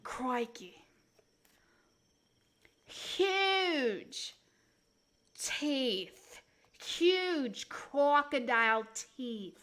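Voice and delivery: put-on slow, stretched Australian wildlife-host accent; Steve Irwin. Showing off a crocodile's teeth, mock Australian awe. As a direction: draw out each word in awed Aussie. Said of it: "Steve Irwin".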